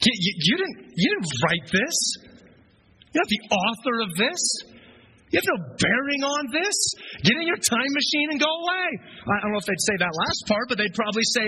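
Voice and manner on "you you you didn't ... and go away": higher, indignant mock protest; hammed-up outraged founders; indignant founding fathers. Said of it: "They'd be like".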